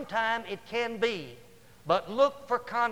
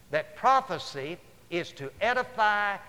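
usual speaking voice

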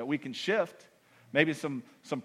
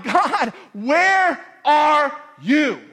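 slow plea; 7 semitones higher, 5 dB louder, much slower than usual. Retold or imitated. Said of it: "Moments where I'm like".

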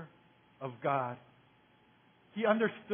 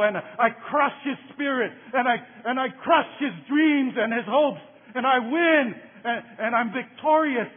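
rising to a loud, triumphant telling; building excitement; 5 semitones higher, 7 dB louder than usual. A narrator's reading. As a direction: pump it up as the win comes closer.

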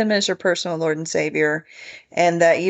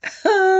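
short laugh; 11 semitones higher than usual. Real, not acted. Laughs at "I'm a poet.

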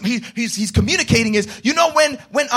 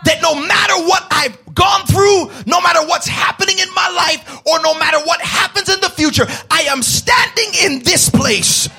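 full-force preaching; 2 semitones higher, 4 dB louder than usual. Acted. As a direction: preach it out to the congregation. Preaching climax.

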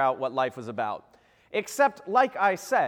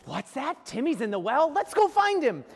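Lassie parody; 9 semitones higher than usual. Retold or imitated. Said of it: "looks down at the dog and says".

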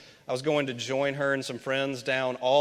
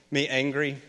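ordinary delivery

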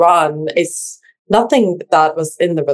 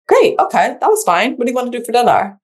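breezy shrug-off; 6 semitones higher, faster than usual. Retold or imitated. Husband's casual reply after outburst.

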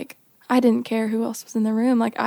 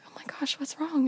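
much softer, whispered concern; hushed worried parents; 4 semitones higher, 8 dB quieter than usual. Retold or imitated. Parents greeting their sobbing daughter, soft worried whisper. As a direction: ask gently what's wrong.

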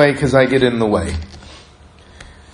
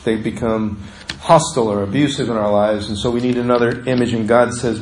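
usual speaking voice

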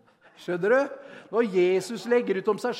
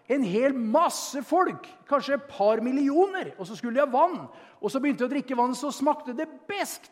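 louder, raised preaching voice, slowing on key lines; fervent preaching; 7 semitones higher, 5 dB louder than usual. Acted. Preacher stirring the congregation with the Mara story, fervent. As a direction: preach it out to the hall.

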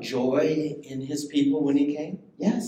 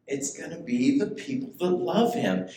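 ordinary talk, nothing marked

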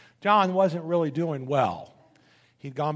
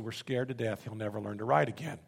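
hushed fear; 6 semitones lower, 6 dB quieter than usual. Retold or imitated. Mother's fear retold in a story.

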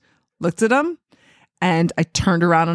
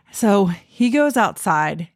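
ordinary talk

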